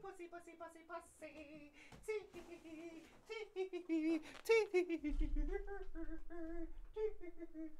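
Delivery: high, squeaky cat noises; very high playful cat squeaks. Pretend. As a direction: squeak like a tiny fleeing cat. Cat voiced running off.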